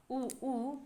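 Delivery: soft drawn-out "उ, ऊ" with rounded lips; vowel demo. Shown as a demonstration. Teacher showing back vowels to students, softly drawn. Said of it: "पश्च स्वर में आ जाते हैं".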